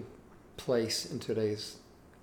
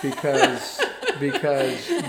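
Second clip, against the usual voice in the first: laughing; 9 dB louder, slower than usual. Real, not acted. Laughing while quoting mother-in-law.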